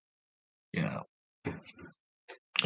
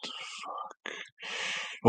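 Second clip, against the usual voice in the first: whispered; 14 dB quieter than usual. Real, not acted. Muttering while recalling a name.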